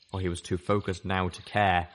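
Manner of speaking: plain reading voice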